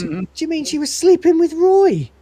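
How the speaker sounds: high-pitched, incredulous outburst; shocked squawk of a question; outraged disbelief. Acted. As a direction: blurt it in scandalized disbelief. Shocked discovery of an affair.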